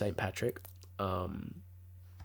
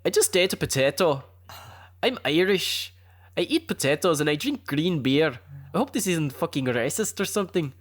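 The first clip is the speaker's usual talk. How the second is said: higher-pitched mock Irish lilt; amateur Irish accent; 5 semitones higher than usual. Shown as a demonstration. Joking demo of how her Irish auntie speaks, bad Irish accent. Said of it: "I'm probably doing it bad".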